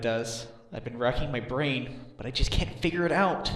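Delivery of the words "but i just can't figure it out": frustrated. Acted. Lawyer frustrated, racking his brain.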